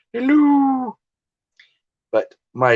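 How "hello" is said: very high falsetto nanny greeting; Mrs. Doubtfire. Retold or imitated. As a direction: draw the hello out high like the film nanny. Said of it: "Miss Doubtfire, thank you".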